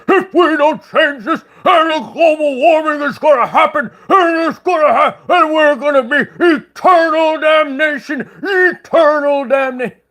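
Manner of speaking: high, loud mocking rant of a TV ranter; hammed-up doomsday ranting, voice pushed high. Mocking a sick man ranting on TV. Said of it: "He was getting all mad".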